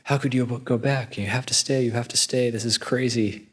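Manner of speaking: high-pitched, incredulous pleading; mock protest. Palace people beg the prince to stay, in high-pitched mock protest. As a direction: act out a whole household objecting, without shouting. Everybody begs prince not to return.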